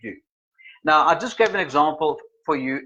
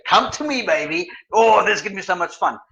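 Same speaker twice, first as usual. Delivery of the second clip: lively, high-pitched gushing; excited influencer; 4 semitones higher than usual. Acted. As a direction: gush like a fun-loving influencer.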